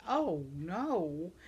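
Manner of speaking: ordinary talk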